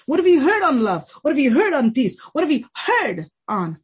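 loud, high-pitched sermon questions; impassioned preaching. Real, not acted. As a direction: preach it hard, question upon question. Preaching with rising urgency.

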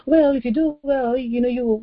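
light, placating tone, set up to be rejected; lenient God, imitated. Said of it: "Now God didn't say".

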